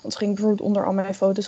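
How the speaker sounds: ordinary delivery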